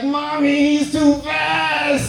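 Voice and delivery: put-on high, loud childish wail; crying little kid. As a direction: whine to mommy, dragging out the last word.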